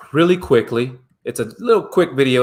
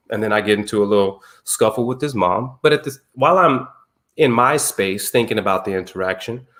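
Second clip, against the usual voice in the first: ordinary talk, nothing marked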